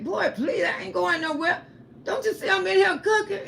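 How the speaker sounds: high, amused brush-off; laughing dismissal. Retold or imitated. Mother laughing at her son's worry, high and amused. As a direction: wave it away with a laugh.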